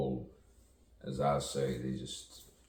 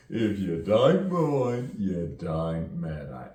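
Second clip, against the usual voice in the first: loud, high, drawn-out mock London accent; hammed-up London accent, slow and loud; 4 semitones higher, 9 dB louder, slower than usual. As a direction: stretch it out in exaggerated put-on London vowels. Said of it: "a little bit like, you know, from London".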